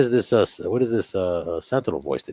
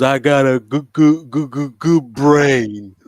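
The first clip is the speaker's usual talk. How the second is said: high, loud stammering simpleton; Simple Jack voice; 6 semitones higher, 12 dB louder, much slower than usual. Retold or imitated. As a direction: drag out the stuttered goods, loud and high. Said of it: "He goes".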